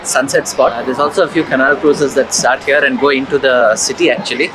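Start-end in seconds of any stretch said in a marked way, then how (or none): none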